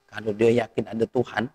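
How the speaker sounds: ordinary talk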